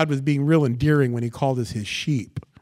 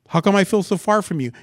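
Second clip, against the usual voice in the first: raised, loud plaintive question; believer's complaint; 6 semitones higher, 5 dB louder than usual. Acted. Believer asking God about the distance, loud raised complaint. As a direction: protest to God, higher and louder.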